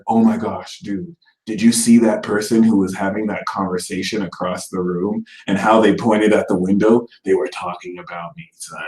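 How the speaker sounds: lightly voiced suspicious friend, low; paranoid Scorpio. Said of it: "it's like".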